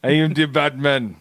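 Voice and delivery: hammed-up accented movie-tough-guy voice, half laughing; bad accented impression, laughter in it. Host repeats his terrible impression, laughing bad impression. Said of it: "you're like Arnold Schwarzenegger saying".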